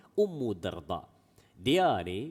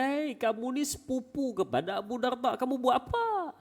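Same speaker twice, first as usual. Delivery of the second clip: high, startled woman's call; shrill-free high challenge from the wife; wife's voice; 13 semitones higher than usual. Retold or imitated. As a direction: voice the wife catching him out. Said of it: "dia kata".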